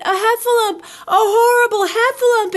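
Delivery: high, loud panicked squeal; Piglet crying out. A narrator's reading. Piglet sees a Heffalump in the trap, panicked cry. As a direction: shriek for help in a tiny panicked voice. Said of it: "cried Piglet".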